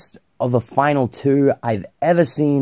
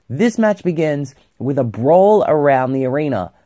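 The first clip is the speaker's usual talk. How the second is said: usual speaking voice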